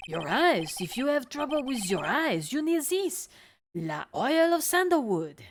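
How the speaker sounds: put-on pushy French salesman accent; french chef. Chef sells oil.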